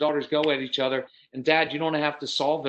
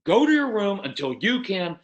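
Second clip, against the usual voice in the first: put-on raised, slowed stern order to a child; stern parent scolding; 5 semitones higher, 4 dB louder than usual. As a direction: send the kid off like an exasperated parent. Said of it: "Typically what we do is".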